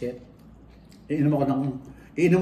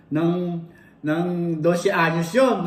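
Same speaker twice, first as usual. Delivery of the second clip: ordinary talk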